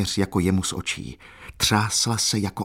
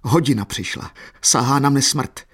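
raised, higher cry of dread; fearful outcry; 6 semitones higher, 3 dB louder than usual. A narrator's reading. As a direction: cry out as death closes in. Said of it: "vzkřikl". (úzkostný výkřik)

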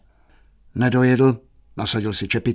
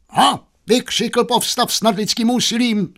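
very high, melodramatic cry; roaring theatrically; 12 semitones higher than usual. Acted. Youth roaring from his book at maid, high melodrama. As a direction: burst out in a heroic high cry. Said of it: "zařval Kamil".

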